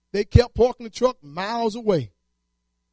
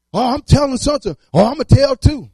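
louder, eager tattletale threat; mock tattling crew; 5 semitones higher, 8 dB louder than usual. Coworkers threatening to tell the boss, loud and eager. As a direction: play a coworker keen to snitch, pushing it out loud.

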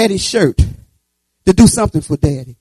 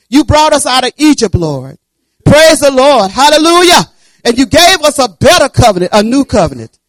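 loud praise; 6 semitones higher, 8 dB louder than usual. Acted. Prayer rising to praise.

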